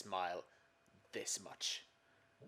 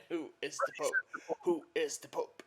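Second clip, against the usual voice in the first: higher, louder playful repeat; loud mock echo; 6 semitones higher, 7 dB louder than usual. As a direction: boom the question back, laughing. Echoed louder, laughing.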